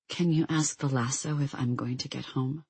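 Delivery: soft, understated near-tearful plea; close to sobbing. A narrator's reading. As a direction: ask it quietly, holding back tears. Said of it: "a shuddering breath that was halfway to a sob".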